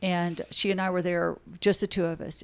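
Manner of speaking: ordinary delivery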